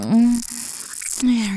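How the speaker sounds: ordinary talk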